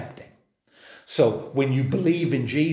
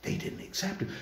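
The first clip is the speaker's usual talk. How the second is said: hushed; 8 semitones higher, 10 dB quieter than usual. Real, not acted. Hushed aside about rejection.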